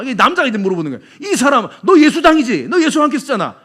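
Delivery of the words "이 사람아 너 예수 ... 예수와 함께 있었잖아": loud, higher insistent challenge; pressing accusation. Acted. Man accusing Peter to his face, loud. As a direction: corner them with raised voice.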